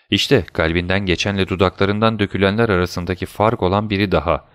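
usual speaking voice

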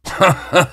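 jeering chuckle in a high voice; mocking laugh. A narrator's reading. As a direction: laugh at your own sob story. Masked man laughs after mock lament.

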